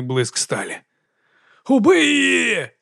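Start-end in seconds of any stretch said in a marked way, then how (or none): 1.7-2.7 high, drawn-out mob cry; shouting. A narrator's reading. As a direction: scream for blood from the crowd. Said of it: "закричав один з паломників".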